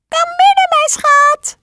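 put-on wolf squeaking like a sweet old lady; wolf faking grandma's voice; squeaky fake granny. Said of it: "riep de wolf met een piepende stem".

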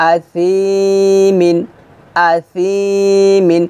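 আসিমিন chanted very slowly, each sound held; drawn-out recitation. Shown as a demonstration. Teacher chanting আসিমিন for students to repeat. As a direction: stretch আসিমিন into a slow chant. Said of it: "হালকা নরম বাতাস".

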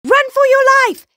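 high, fast, very loud shout; acted terrified screaming; panicked yell. A narrator's reading. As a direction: yell a frantic warning. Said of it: "yelled Rabbit".

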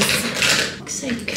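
slow, whispered exasperation under the breath; exasperated. Real, not acted. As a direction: mutter it to yourself, annoyed. Can't find the lip liner.

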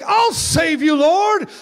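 high, loud mock hero cry; heroic Peter. Preacher voicing Peter rushing to save Jesus, high mock heroic cry. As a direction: ham up an eager action hero.

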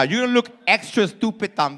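normal talking voice

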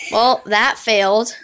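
ordinary delivery

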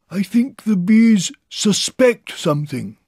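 high, slow, loud bear voice; calling down. A narrator's reading. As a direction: call down a secret warning. Pooh calls down a warning.

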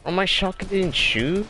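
high incredulous question; can't believe the gun misfired; disbelieving. Real, not acted. Shotgun fails mid-fight.

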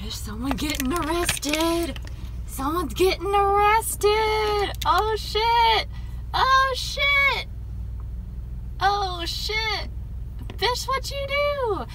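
high, excited gasps of shock; shocked shouting. Real, not acted. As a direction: yell it out in disbelief. Sees an arrest in the parking lot.

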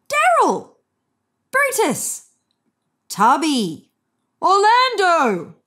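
high, loud calling of names; four owners shouting for their cats. A narrator's reading. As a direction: call each cat across the park.

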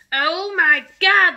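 loud, slow, high cry of horror; horrified yell. Acted. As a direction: stretch it out, aghast at the mirror. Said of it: "the father yelled".

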